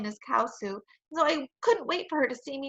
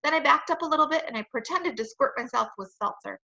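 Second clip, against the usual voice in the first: ordinary delivery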